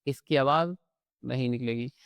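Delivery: usual speaking voice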